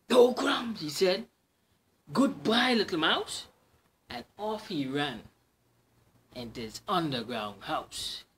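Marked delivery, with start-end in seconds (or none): 0.1-3.5 higher, louder startled voice; a fox scrambling to get away; frightened Fox. Acted. Fox sees the Gruffalo, high startled farewell.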